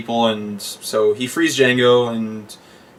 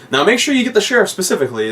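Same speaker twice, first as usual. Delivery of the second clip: quick high mock German; 9 semitones higher, 3 dB louder, faster than usual. Quoting the dentist.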